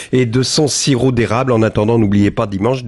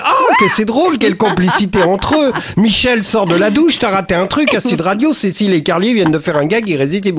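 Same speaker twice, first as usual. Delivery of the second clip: high-pitched mock amazement; hammed-up excited audience reaction; imitating delighted listeners; 7 semitones higher than usual. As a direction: gush like a thrilled radio listener. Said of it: "les auditeurs, pouf, ils disent".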